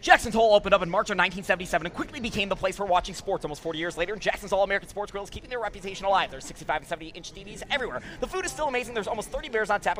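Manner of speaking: auctioneer-fast ad read; as fast as possible. Shown as a demonstration. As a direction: rattle it off as fast as you can. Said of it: "you want me to do as fast as I can".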